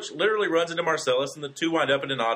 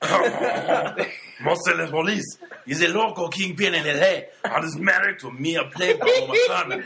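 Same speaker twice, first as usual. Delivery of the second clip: bad, hammed-up French accent, slow and high, through laughter; over-the-top French accent, breaking up laughing; 5 semitones higher, 4 dB louder, slower than usual. Shown as a demonstration. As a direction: read the plot like a cartoon Frenchman, giggling. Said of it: "read it in a French accent".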